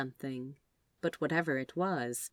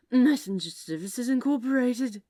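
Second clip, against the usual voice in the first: slurred; 7 semitones higher, 6 dB louder than usual. A narrator's reading. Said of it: "he slurred".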